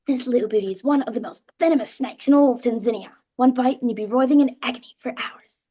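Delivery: put-on booming Tanzanian accent, a nature show presenter. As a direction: announce it loudly in a Tanzanian accent. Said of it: "So I'll just do the Tanzanian accent for you".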